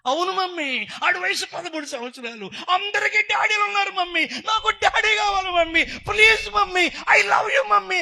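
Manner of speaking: high, loud, preacher-acted child's plea; pleading son. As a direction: beg your mother at full voice. Son begs mother for his father. (గొంతెత్తి అమ్మను బతిమాలే కొడుకు)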